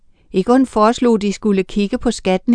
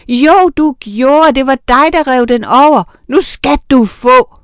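shrill with rage; 4 semitones higher, 7 dB louder than usual. A narrator's reading. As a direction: scream it at him, ready to hit. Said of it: "råbte med en stemme, der var skinger af raseri".

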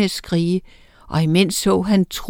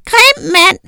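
put-on very high, loud toddler howl; very high loud baby howl; howling baby witch; 11 semitones higher, 7 dB louder than usual. A narrator's reading. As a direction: howl like a scared little witch. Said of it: "hylede Oda".

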